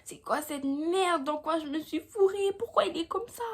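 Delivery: high, anxious retelling of her own panic; rising alarm, not quite crying; panicked whine. Retold or imitated. To her friend, reliving the shock: high distressed whine. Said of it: "je commence à dire".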